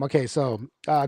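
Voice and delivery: ordinary delivery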